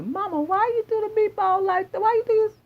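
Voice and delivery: put-on high, whiny child asking mama; whiny kid voice. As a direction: play the kid questioning mom in a high whine. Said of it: "And your kids probably say".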